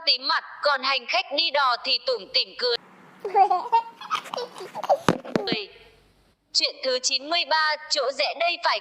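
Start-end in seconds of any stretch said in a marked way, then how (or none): 3.2-5.6 amused laugh. Real, not acted. Laugh after story ends.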